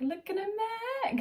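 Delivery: high, drawn-out meme catchphrase; meme imitation. Reactor quoting the lyric she loves, high meme imitation. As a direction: stretch it out high, a little soft. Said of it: "like, all the May memes".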